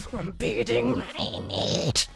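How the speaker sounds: slow, strained creature rasp; alien voice. Retold or imitated. Storyteller imitating Michael at lunch, slow alien creature voice. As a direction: drag it out like some weird alien. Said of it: "He sounded like some alien".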